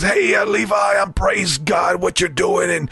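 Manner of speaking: high, shouted preacher blessing; Lou Engle voice. Retold or imitated. Friend phoning in as the preacher to bless Levi, high shout. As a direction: bless the young man like a fervent preacher. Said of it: "he does his Lou Engle voice".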